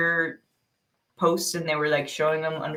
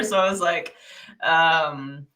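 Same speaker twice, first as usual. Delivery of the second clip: normal talking voice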